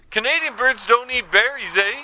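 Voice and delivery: hammed-up Canadian caller tacking on eh; Canadian accent.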